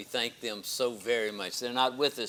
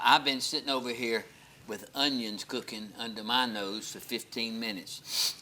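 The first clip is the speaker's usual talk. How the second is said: normal talking voice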